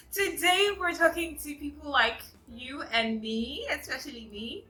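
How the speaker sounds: high, loud, bubbly channel greeting; excited welcome. Real, not acted. Vlogger hyping up her audience, loud and high. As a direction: greet the viewers with big energy. Announcing the topic playfully.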